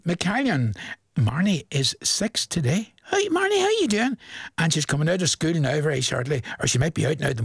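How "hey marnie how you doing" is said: cheery call-out. Real, not acted. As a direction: call her name up high like waving across a room. Presenter calling out to birthday girl.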